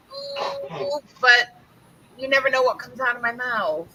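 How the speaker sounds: ordinary talk, nothing marked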